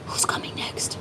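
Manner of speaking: gasp, then a breathy unvoiced whisper; mock whisper. Reader whispering to viewers in mock suspense. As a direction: hush it like a secret. Spooky aside during tarot reading.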